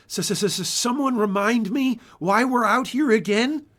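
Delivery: shivering, high stuttering S-s-s; teeth chattering. Acted. Younger farmer freezing in the snow, teeth chattering. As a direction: teeth chattering from the bitter cold. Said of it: "chattered one of the younger farmers".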